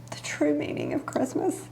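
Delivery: choked-up whisper; emotional whisper. Real, not acted. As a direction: voice catching, whispered. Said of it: "why I'm emotional right now".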